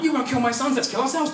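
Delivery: high and loud in disbelief; exasperated. Real, not acted. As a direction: shout it out in disbelief. Exasperated retelling, laughing.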